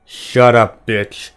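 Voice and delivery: growled command, hard first words. A narrator's reading. Gunslinger silences Detta's protest, growling. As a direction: cut her off with a growl. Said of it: "the gunslinger said in a growl".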